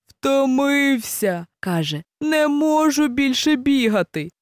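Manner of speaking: slow, drawn-out tired cry; exhausted. Acted. Hare collapsing mid-field, worn out. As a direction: gasp it out, spent.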